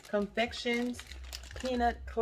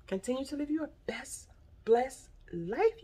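ordinary delivery

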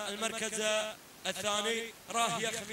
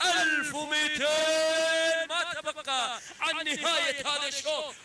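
higher, louder, slowed rising call; excited shout; 7 semitones higher, 5 dB louder, slower than usual. Real, not acted. Race commentator announcing the final stretch, excited shout. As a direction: build the excitement as the race closes.

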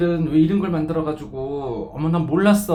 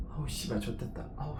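much softer defeated curse; scared; 3 semitones lower, 12 dB quieter than usual. Real, not acted. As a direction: mutter it in dread. Panics at higher pillar. (겁먹고 작게 내뱉는 욕)

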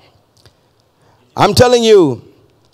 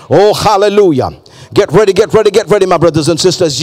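normal talking voice

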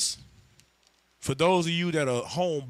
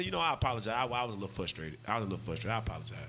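much softer and lower, sheepish; soft apology; 8 semitones lower, 9 dB quieter, much faster than usual. Retold or imitated. Husband quietly apologizes to his calm wife. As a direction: ease into a hushed, humbled sorry.

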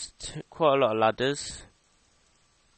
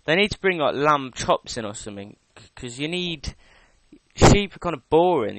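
ordinary talk

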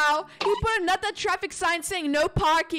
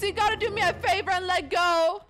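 normal talking voice

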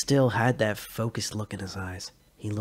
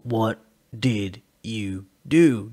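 sullen repeat; 5 dB louder, much slower than usual. Acted. Joe repeats his demand with slow sullen control. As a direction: hold the anger under a slow cold tone. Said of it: "regaining his sullen composure".

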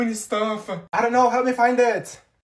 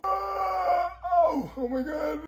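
a sudden higher cry of pain; 10 semitones higher, 4 dB quieter, much slower than usual. Acted. Olivia crying out in pain on the floor. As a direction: cry out as if something hurts.

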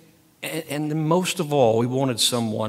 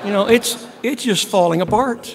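high, loud, laughing fake despair; comic whine about being forgotten, loud and high; laughing mock lament; 9 semitones higher, 7 dB louder than usual. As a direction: ham up the self-pity through laughter. Joking self-pity to crowd.

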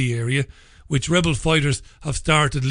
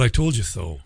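"I told you so" said low and falling; dropped-down admission; 5 semitones lower than usual. Shown as a demonstration. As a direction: drop "I told you so" low, as a final verdict. Owns the phrase just quoted.

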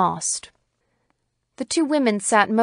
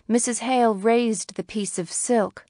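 usual speaking voice

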